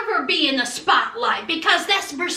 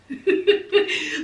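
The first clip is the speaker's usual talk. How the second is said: short run of chuckles; real laugh. Real, not acted. Amused by her own spelling chant, chuckling.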